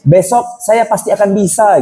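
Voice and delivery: high, hammed-up contestant's cry; playful high-pitched mock yell; mock contestant shout. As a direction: imitate a happy loser calling out to camera. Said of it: "Dia selalu teriak".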